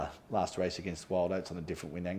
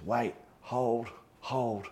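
retold urgent cry, clearly higher and a touch louder; urgent call to hold; 6 semitones higher, 3 dB louder, much slower than usual. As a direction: act out the navigator rushing in to stop the tack. Navigator rushes up urging to wait.

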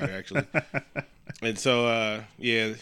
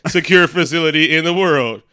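loud, higher mock PA announcement; announcing into mic; 4 semitones higher, 8 dB louder than usual. Character talking into the mic, boastful announcement. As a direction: proclaim it pompously as if miked. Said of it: "he's talking into the mic".

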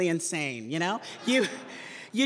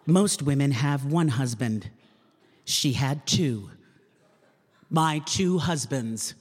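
promo voiceover read; 3 semitones lower than usual. Acted. Voice actor reading the network promo in booth, dramatic announcer voice. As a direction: sell the TV movie like a dramatic promo. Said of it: "Another script".

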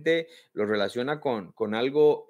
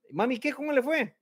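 put-on eager child; 11 semitones higher, faster than usual. Child asking mother after meeting.

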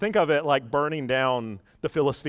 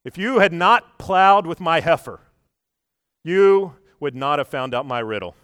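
mock angry insult; 3 semitones higher, 7 dB louder than usual. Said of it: "what does he say?".

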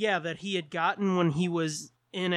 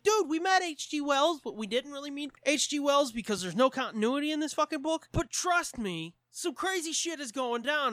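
high-pitched, worked-up comic explanation; mock urgent insistence; 8 semitones higher than usual. Holmes convincing a doubting Watson, comically urgent. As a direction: insist like an exasperated buddy.

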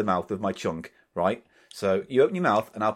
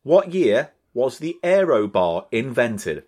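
slow, loud, carefully spaced query; voice command; 6 dB louder, slower than usual. Real, not acted. Host asks Google about the Aero bar, slow voice command. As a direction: spell it out slowly for the machine.

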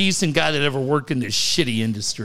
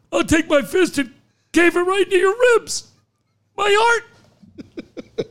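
much higher, strained caller threat turning to a heart attack; hysterical caller; 13 semitones higher than usual. Pretend. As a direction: squeal the threat, then gasp my heart. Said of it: "caller threatens, clutches heart".

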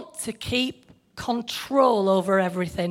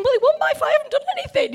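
indignant protest; much faster than usual. Retold or imitated. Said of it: "And I'm thinking".

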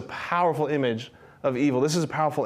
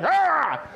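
hammed-up startled yell; 10 semitones higher than usual. George recoiling from Bert with a startled cry. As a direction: yelp like a spooked man. Acting out George's scared reaction.

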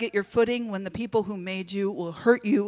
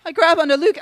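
plain reading voice

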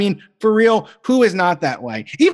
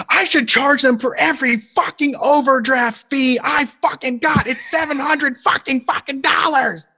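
higher, building to a shout on the last words; mock angry rant; 8 semitones higher than usual. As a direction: rant like a fed-up worker who got overdrafted. Angry employee ranting about overdraft fees.

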